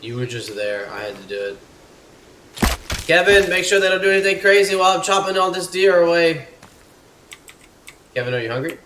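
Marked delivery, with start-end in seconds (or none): 3.1-6.5 high, loud shouted instruction; sustained raised-voice yell; calling out orders. Real, not acted. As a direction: holler to your companion while busy.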